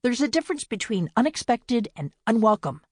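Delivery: cold retort. A narrator's reading. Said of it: "Winnie turned on her".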